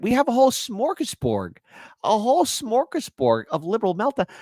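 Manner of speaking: high, showman-like excitement; hyped gloating. Acted. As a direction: announce it like a buffet. Hyped host.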